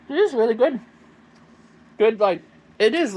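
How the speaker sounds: loud, eager praise; excited. Real, not acted. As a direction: rave about the flavor. Enthusiastic tasting.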